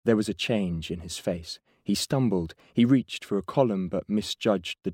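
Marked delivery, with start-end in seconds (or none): none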